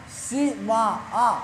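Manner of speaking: सीमा slow, trailing into a separate long आ; खींचकर उच्चारण. Shown as a demonstration. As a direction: split सीमा into सी, मा, then sound आ. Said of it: "उच्चारण जैसे कुछ करने के बाद आ". (सीमा धीरे बोलकर अंत का आ अलग से)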